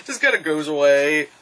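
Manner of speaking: ordinary delivery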